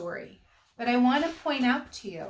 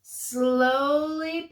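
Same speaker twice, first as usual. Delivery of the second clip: ordinary delivery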